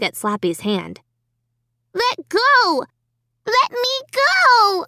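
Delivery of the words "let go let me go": put-on high child's voice, angrily pulling free; angry little-girl cry. A narrator's reading. Little girl at a party angrily pulling her hand free, high angry cry. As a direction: yank away and cry it out, high and cross. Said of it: "Alicia cried angrily".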